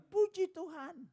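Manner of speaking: high parrot echo. Retold or imitated. Parrot copying the praise, high voice. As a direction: chirp it back like a parrot.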